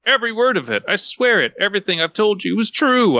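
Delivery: high, insistent protest; yelled. A narrator's reading. Patient yelling after the departing doctor, high insistent protest. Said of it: "I yelled out".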